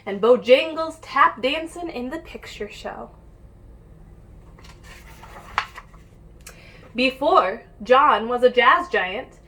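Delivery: put-on lively read-aloud for kids; picture book reading. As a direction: read it like storytime for little ones. Reads picture book to children.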